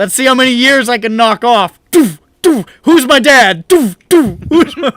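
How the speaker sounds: very loud, high, hammed-up frenzy; over-the-top mock madman; manic shouting. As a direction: yell wildly between pretend hammer blows. Acting self-hammering, brain-damage contest.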